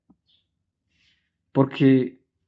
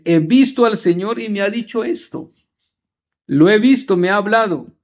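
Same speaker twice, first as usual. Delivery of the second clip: higher, eager proclamation of good news; excited announcement; 6 semitones higher than usual. Retold or imitated. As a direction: burst out with joyful news. Magdalena announces the risen Lord.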